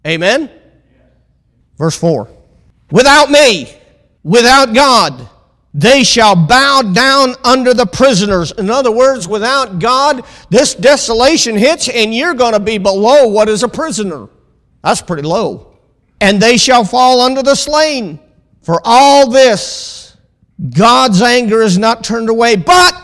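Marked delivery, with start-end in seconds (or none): 2.9-14.3 raised, loud scripture proclamation; ringing pulpit warning; forceful preaching. Real, not acted. 16.2-22.6 raised, loud scripture proclamation; ringing pulpit warning; forceful preaching. Real, not acted. 22.6-22.9 high, loud cry of BUT; emphatic shout. Real, not acted. Preacher cries out key word.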